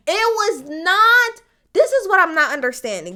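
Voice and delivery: pissed off. Real, not acted. Fed-up commentator objecting to the double jeopardy claim, pissed off. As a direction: burst in with exasperated emphasis. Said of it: "This is what's pissing me off so much".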